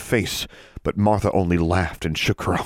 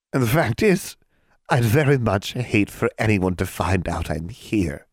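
hag voice; 4 semitones higher than usual. Acted.

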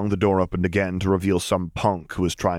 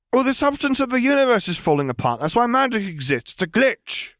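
put-on higher, matter-of-fact girl's voice; girl brushing off talk of a dead lord, higher; young girl Lily; 12 semitones higher than usual. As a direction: say it lightly, as if it's nothing.

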